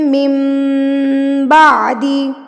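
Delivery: slow मिन बादी, n turned into a long hummed m; iqlab recitation. Shown as a demonstration. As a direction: hum "mim" before the b in मिन बादी. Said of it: "नून साकिन की आवाज़ को मीम में".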